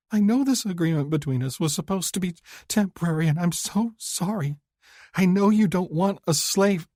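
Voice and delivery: high, quick apologetic woman's voice through tears; tearful young woman. Acted. Woman apologising to her lover, high and tearful. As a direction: she is sorry and afraid he'll go.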